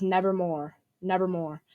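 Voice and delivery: normal talking voice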